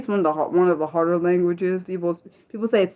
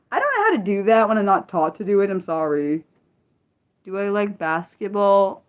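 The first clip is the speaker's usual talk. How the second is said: ordinary talk, nothing marked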